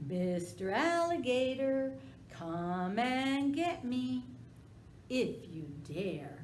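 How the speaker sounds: high, loud sing-song taunting; dog taunting the alligator loudly; teasing taunt. Acted. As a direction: taunt him from the porch. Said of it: "he teased Alligator".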